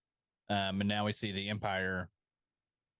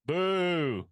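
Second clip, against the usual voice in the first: high, loud, drawn-out boo; mock booing; 9 semitones higher, 7 dB louder than usual. Cohost jeering Sid for what she did, playful booing. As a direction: jeer it out like a heckler.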